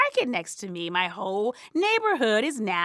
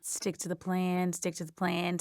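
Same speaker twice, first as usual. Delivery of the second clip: quiet mantra; 5 semitones lower, 8 dB quieter than usual. Acted. Insomniac self-talk, soft mantra.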